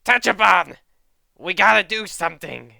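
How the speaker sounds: hammed-up rough battle voice; Inosuke voice. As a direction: yell like a reckless fighter. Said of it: "I'll just use this voice for Inosuke".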